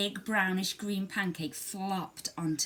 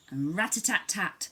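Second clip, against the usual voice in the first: plain reading voice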